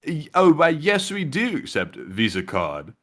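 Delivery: slow, measured mock British accent; British man. British guy answering a tourist about Visa, mock British accent. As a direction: put on a drawn-out British gentleman's accent. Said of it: "that British guy".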